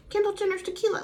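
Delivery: high mocking sneer at the name; mock the words Kendall Jenner's tequila; mocking repeat. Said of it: "she's literally mocking it".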